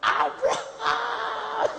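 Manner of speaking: slow, drawn-out, laughing mock shout; old wife calling her husband, slow laughing shout. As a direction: stretch the name out as an old woman calling, laughing.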